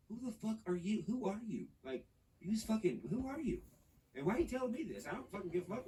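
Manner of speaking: high, exasperated mock outburst, pitch climbing; acting out snapping at a stranger who bossed him; retold angry retort. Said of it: "I'm like".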